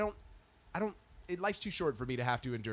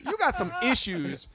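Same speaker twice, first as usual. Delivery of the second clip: louder, raised lecturing voice; mock scolding; 5 semitones higher, 7 dB louder than usual. Man lecturing the parking lady, raised scolding. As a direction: tell her off. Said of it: "my top blew".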